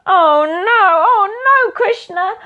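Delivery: high, loud playful wail; hammed-up "oh no" for children; mock alarm. Storyteller reacting to the falling mortar, high mock alarm.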